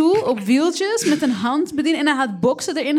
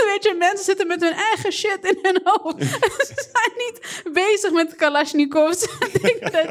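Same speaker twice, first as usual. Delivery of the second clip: laughing; 6 semitones higher than usual. Real, not acted. Still laughing at the story.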